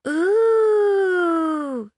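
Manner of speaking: high drawn-out ooh; long delighted "ooh". Acted. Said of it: "said Aiko".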